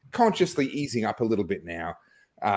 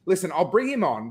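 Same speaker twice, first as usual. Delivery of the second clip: ordinary talk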